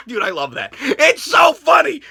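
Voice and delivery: ordinary talk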